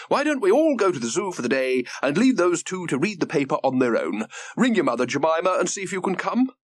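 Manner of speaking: high, lively granny voice; an eager grandmother, delighted by the news. Acted.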